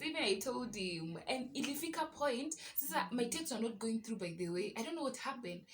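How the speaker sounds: usual speaking voice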